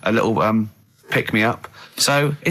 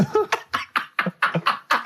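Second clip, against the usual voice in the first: breathy laugh; 13 semitones higher, 5 dB quieter than usual. Real, not acted. Laughs at own joke.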